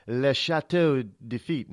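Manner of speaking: "Le Chateau de Lafitte" in a put-on French accent, nasal vowels; mock French. Shown as a demonstration. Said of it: "we say it in French".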